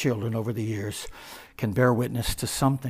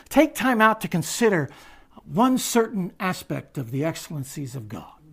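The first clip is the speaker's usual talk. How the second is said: rising exhortation; 4 semitones higher, 6 dB louder than usual. Real, not acted. Preacher exhorting congregation.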